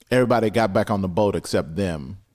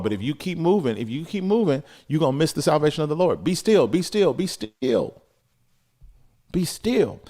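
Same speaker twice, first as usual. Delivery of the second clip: full-force preaching; 8 semitones higher than usual. Real, not acted. Preacher urging the congregation to be still, full force. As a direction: preach it out to the room, rising.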